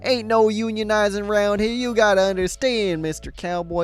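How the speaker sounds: put-on high-pitched cowboy accent. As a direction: warn them in a hammed-up high cowboy accent. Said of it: "two separate cowboy accents".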